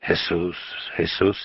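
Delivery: mumbled. A narrator's reading. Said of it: "he mumbled".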